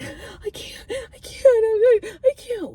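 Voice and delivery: high, breaking wail; high teary wail of I can't; crying whine. Retold or imitated. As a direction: sob it out like a child. Crying she can't chill.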